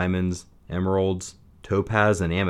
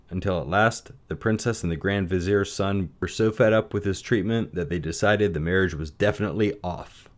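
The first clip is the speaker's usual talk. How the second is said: plain reading voice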